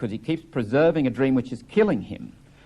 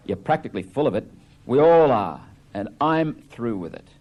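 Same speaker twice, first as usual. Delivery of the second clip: Biff scornful; 3 semitones higher, 4 dB louder than usual. Acted. Biff reading, mocking Happy.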